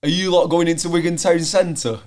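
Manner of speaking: calling out loudly after them; retold shout. Director shouting after the lads, loud. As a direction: shout it down the alley. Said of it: "he shouted after us and went".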